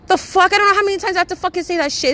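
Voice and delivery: high, loud, fast exasperated rant; angry outburst at a friend. Real, not acted. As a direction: spit it out, fed up.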